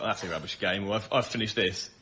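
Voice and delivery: normal talking voice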